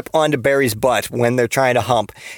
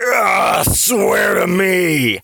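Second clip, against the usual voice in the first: higher, drawn-out strained shout; Batman; 6 semitones higher, much slower than usual. Acted. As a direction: roar it out slowly in an exaggerated vigilante voice.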